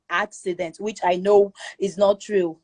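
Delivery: ordinary talk, nothing marked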